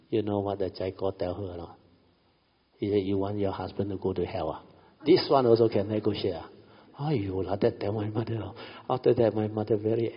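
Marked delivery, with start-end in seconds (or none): 3.0-6.5 mocking scold building to a louder demand; imitating a pushy monk guilt-tripping a widow; retold rebuke. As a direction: threaten like a pushy funeral monk, voice rising. Chai Ko scolding grieving mother.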